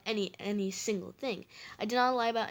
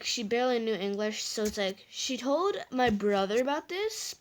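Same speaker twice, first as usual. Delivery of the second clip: ordinary talk, nothing marked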